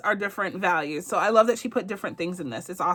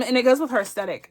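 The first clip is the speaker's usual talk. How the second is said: normal talking voice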